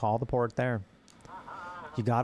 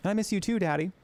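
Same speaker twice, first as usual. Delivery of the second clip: put-on child's voice; 8 semitones higher, faster than usual. Daughter talks to father.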